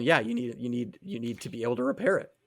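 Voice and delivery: normal talking voice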